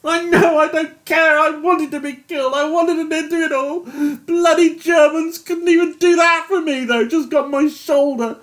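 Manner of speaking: high, loud tearful anguish; a broken man begging to go home; weeping despair. A narrator's reading. Yates weeping confession.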